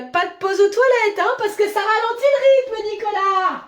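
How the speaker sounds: high-pitched bossy sing-song; singsong nagging. Acted. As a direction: chide him in a high singsong. Boss nags employee in singsong.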